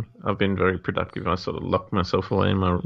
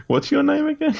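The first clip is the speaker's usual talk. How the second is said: laughing. Pretend. Mock police arrest joke.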